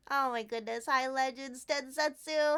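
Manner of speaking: ordinary talk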